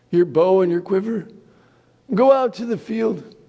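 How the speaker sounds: a frail blind father giving orders; old Isaac. Acted. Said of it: "He said".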